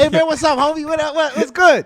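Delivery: loud, high-pitched buddy hello; casual homie greeting. Acted. Worker greeting a homie on the job, loud and familiar. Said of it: "then you see the H R people".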